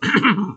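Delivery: a pointed throat clear of displeasure; disapproving ahem. A narrator's reading. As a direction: clear the throat to show annoyance. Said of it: "my customary clearing of my throat".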